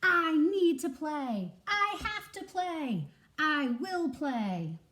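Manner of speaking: slow, loud, insistent declaration; desperate squirrel begging to play; determined shout. Acted. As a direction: hammer each line home like a vow. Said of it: "he shouts".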